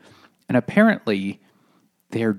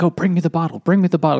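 eager giant voice; 6 semitones higher, 3 dB louder, much faster than usual. Retold or imitated. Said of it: "he's like".

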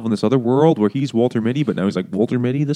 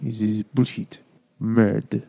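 put-on French accent; slower than usual. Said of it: "I picture him like".

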